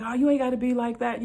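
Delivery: imitating the sergeant. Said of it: "he's like".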